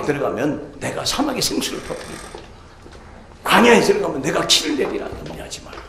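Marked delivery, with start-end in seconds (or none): 5.3-5.8 whispered reassurance. Acted. Voicing God comforting the worried believer, whispered. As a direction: breathe it gently to calm them.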